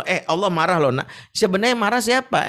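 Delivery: ordinary talk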